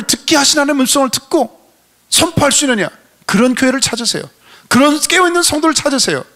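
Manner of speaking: high, impassioned sermon exhortation; fervent preaching. Real, not acted. Preaches at full force. (열정적으로 높여 외치는 설교)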